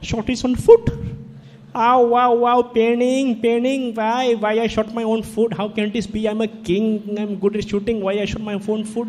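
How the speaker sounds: high, loud hammed-up cries of pain in an Indian accent; a pompous king howling over his own foot; comic royal yelp of pain; wounded king wailing.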